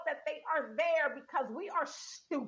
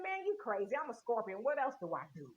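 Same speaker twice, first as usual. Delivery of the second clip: shrugging scorpion; 6 semitones lower, 5 dB quieter than usual. Acted. Scorpion shrugs off the frog's shock, casual and low. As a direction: lower and softer, a casual shrug of a voice.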